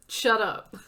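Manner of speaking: loud, firm cut-off of a friend's self-pity; loud shut up. Acted. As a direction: shout it down so she stops. Friend cuts off her self-blame.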